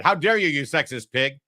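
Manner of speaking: mock indignant retort; mock outrage. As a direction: play the outraged listener. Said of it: "If I said that".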